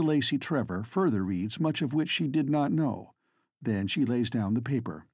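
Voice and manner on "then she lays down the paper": usual speaking voice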